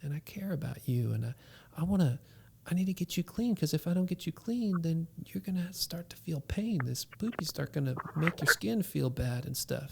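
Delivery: soft whispering comfort; father gently soothing his upset son; soothing dad. Retold or imitated. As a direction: whisper love to calm the child. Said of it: "I was like".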